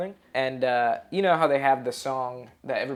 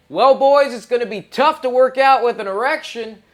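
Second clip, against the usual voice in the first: joking; 9 semitones higher, 10 dB louder than usual. Retold or imitated. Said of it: "I go".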